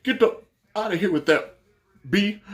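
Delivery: high, loud mock outburst; retold angry rant with bleeped gaps; imitating cursing manager. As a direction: snap it out as a fed-up security boss. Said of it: "He actually starts cursing".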